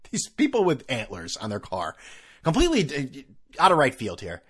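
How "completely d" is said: ordinary talk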